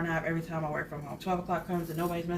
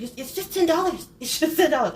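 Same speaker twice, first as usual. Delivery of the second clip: high, louder, imitating the eyebrow lady; mock angry insistence; 11 semitones higher, 9 dB louder than usual. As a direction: insist hard on the price, hammed up. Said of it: "she gets so mad".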